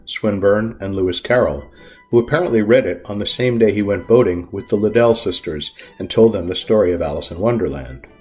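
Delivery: ordinary delivery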